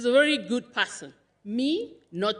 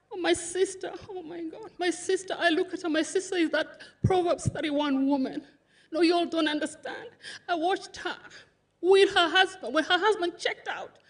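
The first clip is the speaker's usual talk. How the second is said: tearful praise; 6 semitones higher than usual. Real, not acted. Praising grieving sister at memorial.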